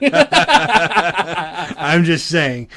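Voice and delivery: loud, drawn out through real laughter; laughing. Real, not acted. Friend defends his pause-button joke, laughing loudly. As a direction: defend yourself while cracking up.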